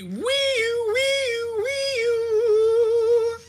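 high siren wail with the mouth; loud mock alarm, wee-oo; mouthed car alarm. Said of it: "we already have a fucking bit".

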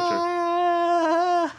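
a cutesy whiny pose voice for the photo; photo pose squeak. Pretend. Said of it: "make that face or sound".

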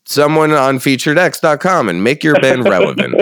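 lightly voiced ad pitch; half-hearted commercial voice; mock ad read. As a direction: pitch it like a cheesy ad.

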